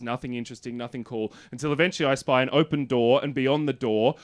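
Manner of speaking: ordinary delivery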